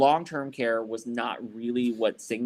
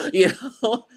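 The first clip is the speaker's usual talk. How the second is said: laughing; 11 semitones higher, 6 dB louder than usual. Real, not acted. Laughs after punchline.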